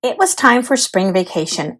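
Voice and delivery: usual speaking voice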